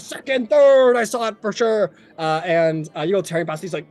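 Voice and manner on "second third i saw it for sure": loud, high race call; excited shouting. Acted. Racer calls out as riders tear past, excited shouting. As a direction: call the placings at full excitement.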